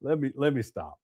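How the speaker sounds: ordinary talk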